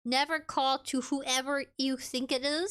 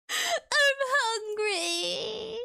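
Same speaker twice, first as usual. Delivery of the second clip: laughing whine; 10 semitones higher, 3 dB louder, much slower than usual. Real, not acted. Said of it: "laughing, whining about chicken".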